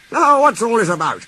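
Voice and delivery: a hint of a stern manager; Fat Controller. A narrator's reading.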